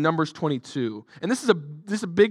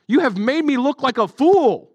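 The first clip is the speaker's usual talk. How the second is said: higher, louder angry shout; 7 semitones higher, 6 dB louder than usual. Acted. Balaam to his donkey, shouting angrily. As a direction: yell it at the donkey in fury. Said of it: "Balaam shouted".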